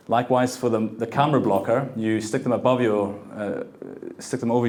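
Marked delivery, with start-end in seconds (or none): none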